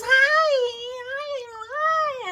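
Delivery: very high, loud squeaky greeting; Minnie. Retold or imitated. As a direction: imitate a super-high mini cartoon voice. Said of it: "it sounded just like Minnie".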